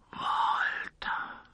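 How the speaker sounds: voiceless, stunned whisper; soft, dumbfounded whisper; stunned disbelief. A narrator's reading. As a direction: breathe the name, aghast. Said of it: "wiederholte der Revolvermann fassungslos".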